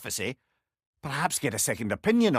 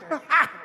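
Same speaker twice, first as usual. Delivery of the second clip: scornful laugh; 13 semitones higher than usual. Acted. Laughs at Odin's folly.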